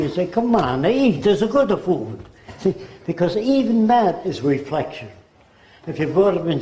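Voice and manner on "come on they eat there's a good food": mock Italian accent, a bit higher; playful Italian-accented voice. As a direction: imitate an eager Italian host, Italian accent. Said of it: "If you're brought up in Italy".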